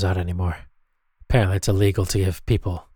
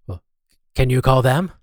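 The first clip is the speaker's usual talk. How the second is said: normal talking voice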